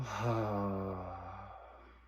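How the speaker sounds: long, open sighing exhale; drawn-out "haah" of release; demonstrated sigh. Shown as a demonstration. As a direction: let a big breath fall out audibly. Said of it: "you can sigh on the exhale".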